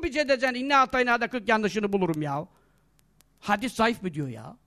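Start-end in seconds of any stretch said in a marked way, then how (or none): 3.4-4.6 low, soft scornful echo; weary scorn, dropped low; dismissive disbelief. Retold or imitated. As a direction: repeat it under your voice, dismissively. Scornful repeat of the doubter's question.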